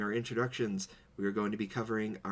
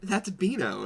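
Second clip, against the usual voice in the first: high mock realization; 10 semitones higher, 4 dB louder, slower than usual. Retold realization.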